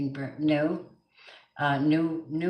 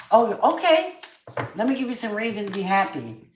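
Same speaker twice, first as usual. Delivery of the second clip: high, loud, obliging Spirit voice; Spirit responding; 6 semitones higher, 5 dB louder than usual. Acted. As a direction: pitch it up, eagerly agreeing as Spirit. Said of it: "Spirit goes".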